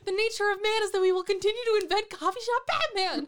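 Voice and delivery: high, loud mock proclamation; grand proclaiming voice.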